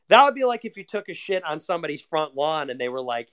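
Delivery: ordinary talk, nothing marked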